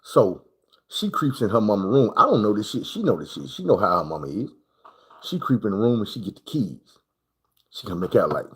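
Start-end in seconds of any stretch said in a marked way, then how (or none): none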